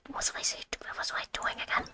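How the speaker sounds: whispered to herself, losing track; breathy whispered self-question. Real, not acted. Streamer forgets her task, whispers to herself. Muttering to herself mid-game.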